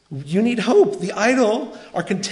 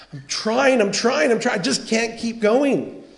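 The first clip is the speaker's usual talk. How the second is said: higher-pitched, urgent pleading; acted desperation, voice pitched up; faint-hearted struggler; 6 semitones higher than usual. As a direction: plead as if stuck and about to give up. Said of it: "That's the faint-hearted person".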